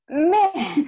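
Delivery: high, loud, drawn-out groaned word; hammed-up "oh come on" reaction; mock exasperation. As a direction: play up the exasperation for laughs. Retold exasperated reaction, joking.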